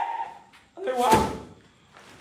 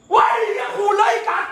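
loud, higher startled yell; startled shout; 4 semitones higher, 8 dB louder than usual. Real, not acted. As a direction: jump and yell at the noise. (ตะโกนตกใจจริง)

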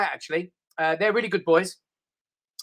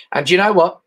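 ordinary delivery

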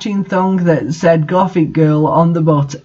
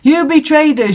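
higher, hammed-up accusation; mock angry shout; 8 semitones higher than usual. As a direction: accuse them in melodramatic anger. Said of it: "shouted Vampire angrily".

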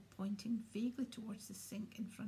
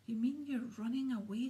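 loud, disbelieving question; can't believe his ears, a touch louder; 3 semitones higher, 8 dB louder than usual. A narrator's reading. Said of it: "said Harry disbelievingly".

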